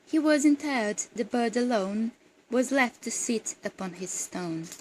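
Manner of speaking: measured, projected verse reading; poem recitation. Acted. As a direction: read the poem aloud, letting each couplet's end fall. Reads a poem aloud.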